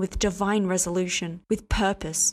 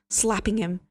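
plain reading voice

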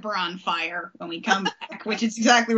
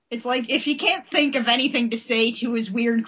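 normal talking voice

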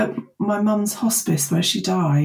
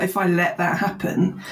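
ordinary talk

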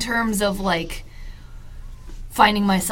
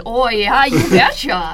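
put-on lightly louder Northern Wisconsin accent; Wisconsin you betcha; 3 semitones higher, 7 dB louder than usual. Friend applauding the accent with a Wisconsin you betcha. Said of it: "that was a solid accent".

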